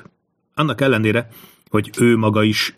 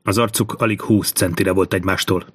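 ordinary delivery

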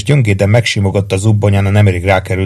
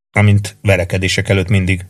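ordinary delivery

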